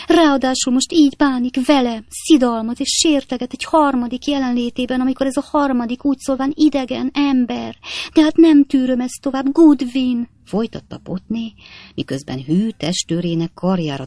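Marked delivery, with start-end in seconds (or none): 0.1-10.3 higher, louder theatrical sobbing. A narrator's reading. Wife sobbing to her maid about her husband, theatrical. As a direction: wail it through sobs. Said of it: "zokogott Potné".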